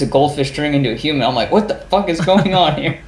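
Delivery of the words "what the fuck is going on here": higher-pitched mock disbelief; comically baffled outburst; acted bafflement. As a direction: replay your bewildered reaction, pitched up. Acting out his baffled reaction.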